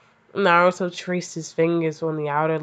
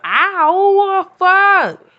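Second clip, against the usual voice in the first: high, loud fake cry of pain; shrieking at the word rammed; mock pain yelp; 11 dB louder than usual. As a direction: yelp as if it hurt you. Reacting to "he rammed inside.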